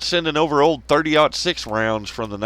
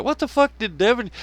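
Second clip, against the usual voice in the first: mock disbelief; 11 semitones higher than usual. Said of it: "And you're like".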